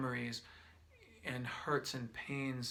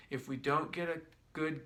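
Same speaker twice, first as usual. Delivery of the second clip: ordinary talk, nothing marked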